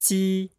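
Ji held high and level, Mandarin ji with a ts-like start; pinyin ji first tone. Shown as a demonstration. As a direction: say Ji on one high flat pitch. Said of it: "Let's try saying that a few times".

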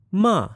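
MA starts high and falls sharply; falling tone. Shown as a demonstration. Teacher demonstrates Chinese sounds, falling tone. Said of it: "And the Chinese sounds".

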